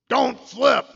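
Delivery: loud high shout; sudden shouted command, voice jumping high; game callout. Retold or imitated. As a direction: yell it like the machine warning you. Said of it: "and I would go".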